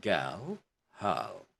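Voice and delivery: put-on "girl", "hurl" as "guhl", "huhl"; one syllable each in "girl" and "hurl", no R; Queen's English, silent R. Shown as a demonstration. Teacher models Queen's English, silent R in "girl" and "hurl". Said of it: "In British or Queen's English".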